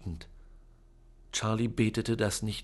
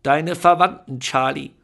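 loud, higher, proud proclamation; grand aunt; 4 semitones higher, 12 dB louder than usual. A narrator's reading. Aunt proudly telling Charlie about his relatives. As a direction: announce it with pride. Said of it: "sagte Tante Lucretia".

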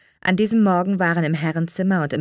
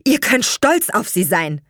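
mildly heated, raised insistence; agitated; 5 semitones higher, 5 dB louder than usual. A narrator's reading. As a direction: press the parents indignantly. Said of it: "fragte Leonard aufgebracht". (aufgebracht, mit erhobener Stimme)

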